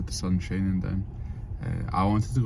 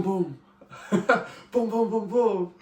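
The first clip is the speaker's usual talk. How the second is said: excited laughing booms; 13 semitones higher, slower than usual. Acted. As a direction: chant it like a victory dance.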